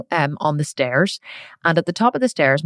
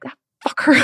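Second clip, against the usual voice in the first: higher, sulky hurt mutter; indignant child; 8 semitones higher than usual. Retold or imitated. Hurt girl cursing dad under her breath, higher. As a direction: grumble it as a hurt kid. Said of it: "And I was like".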